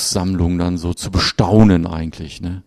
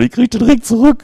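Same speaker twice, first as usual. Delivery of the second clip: very high, loud gleeful squeal; hammed-up high-pitched excitement; excited wife; 8 dB louder than usual. Voicing the wife's excited hope.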